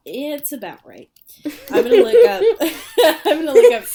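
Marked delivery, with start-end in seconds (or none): 1.7-3.8 loud, high laughing outburst; laughing loudly. Real, not acted. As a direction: blurt it out while laughing.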